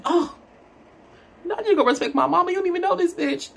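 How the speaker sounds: high, fast, mock-outraged squeal; mock incredulous. Retelling her joking reply to the date about her mama, high mock outrage. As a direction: fake shock, then toss the line off, quick and high.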